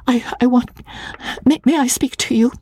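high, breathless stammering plea; nervous bride asking the clerk for help; timid young wife. A narrator's reading. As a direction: stammer it out, half whispered.

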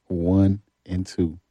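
"One and two" drawn out slowly; slow and measured. Real, not acted. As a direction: spell out "One and two" at half speed. Repeats record slowly to reassure.

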